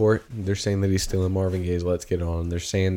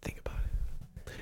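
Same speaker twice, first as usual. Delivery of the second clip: breathy mock-mysterious whisper; 15 dB quieter, slower than usual. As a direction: whisper it like a secret. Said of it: "What the hell was that?".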